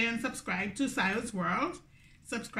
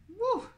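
high woo; 13 semitones higher than usual. Real, not acted. Reaction to spicy bite.

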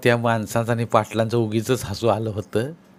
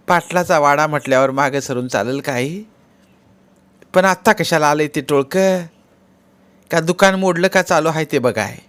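village woman in a higher voice; questioning sister-in-law; 5 semitones higher, 4 dB louder than usual. Acted. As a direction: question him, voice lifted and a bit louder. (चढ्या आवाजात विचारणारी वहिनी)

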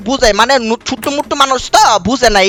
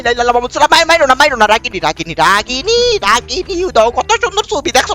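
normal talking voice

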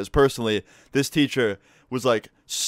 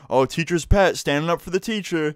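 high-pitched jeer; mocking taunt; 7 semitones higher, 3 dB louder than usual. Student jeers at classmate defending the teacher, mocking taunt. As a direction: imitate a kid needling a classmate.

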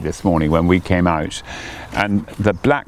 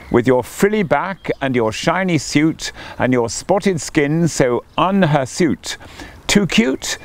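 lightly voiced gushing coot, pitched high; emoting coot; 7 semitones higher than usual. A narrator's reading. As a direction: gush over the newt, high and sweet. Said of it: "Emoted the coot".

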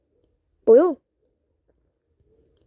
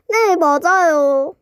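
slow drawl; 3 dB louder, much slower than usual. Acted. Drawled repeated answer.

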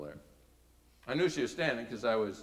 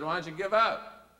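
louder, higher, rising question; leader's challenge; 6 semitones higher, 6 dB louder than usual. Retold or imitated. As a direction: throw it out as a challenge. Leader's challenge, first take louder.

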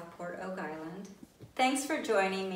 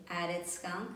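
ordinary delivery, nothing marked

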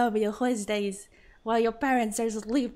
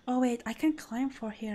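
ordinary delivery

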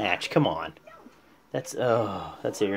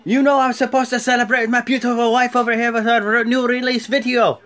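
mock indignant Rusev; 9 semitones higher, 4 dB louder than usual. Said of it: "He's like".

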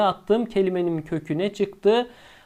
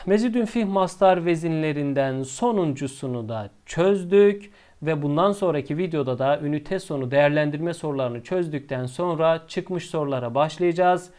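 ordinary talk

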